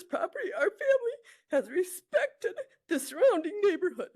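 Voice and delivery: choking up over the family land; crying. Real, not acted. Aunt telling the committee about family land, crying. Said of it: "I'm a little emotional".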